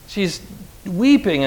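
normal talking voice

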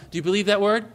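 ordinary talk, nothing marked

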